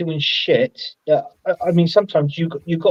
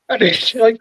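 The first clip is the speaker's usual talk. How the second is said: ordinary delivery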